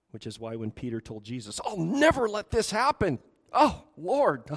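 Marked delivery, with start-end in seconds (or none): 1.6-4.5 high, loud, hammed-up vow; impassioned protest. Peter swearing to Jesus he will prevent it, acted impassioned protest. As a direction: cry out in mock-dramatic refusal.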